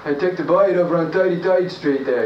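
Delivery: thick New York street accent. Shown as a demonstration. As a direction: call it out like an old New York street guy. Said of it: "which melds into New York".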